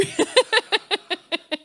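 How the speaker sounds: high, bright falling laugh; delighted laugh. Real, not acted. Laughing after telling the baby moo story, delighted laugh. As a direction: let the giggle spill out.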